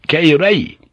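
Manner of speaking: loud, high ceremonial order; captain's sharp call to salute; cried-out command. A narrator's reading. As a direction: cry it out to the whole crew. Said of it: "the captain cried out".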